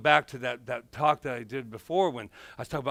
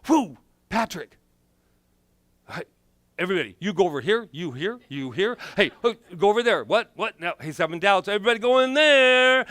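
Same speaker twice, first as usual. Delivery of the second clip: fast, high and loud, a busy crew barking orders; bustling cells; 4 semitones higher, 6 dB louder than usual. Pretend. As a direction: direct the cells like a frantic foreman. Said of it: "all the trillion cells were all together".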